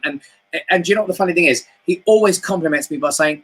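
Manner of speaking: ordinary talk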